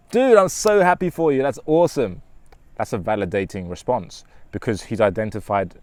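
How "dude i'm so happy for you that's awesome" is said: much higher and louder, thrilled; excited happy for you. Shown as a demonstration. Friend hearing of the lottery win, gushing. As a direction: gush with real joy for a friend. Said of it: "But if he's like".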